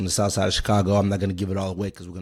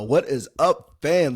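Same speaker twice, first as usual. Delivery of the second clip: podcast intro; 5 semitones higher, 3 dB louder, much slower than usual. Acted. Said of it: "podcast intro greeting".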